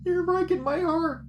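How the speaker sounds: overwhelmed emotional wail; real anguish at the game; high, loud heartbroken cry. Real, not acted. Overwhelmed by scene.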